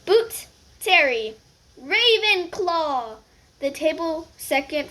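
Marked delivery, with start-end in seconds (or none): 0.0-1.4 louder, slow name call. Acted. Names called out. 1.8-3.2 loud, slow drawn-out announcement; sorting hat proclaiming; hat announcing. Acted.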